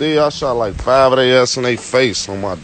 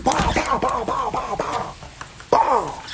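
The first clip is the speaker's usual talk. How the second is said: quick mouthed gunshots, pitched up; higher, softer gunshot noises; 9 semitones higher, 7 dB quieter than usual. Retold or imitated. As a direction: tap out the shots with your voice. Mimicking gunshots again.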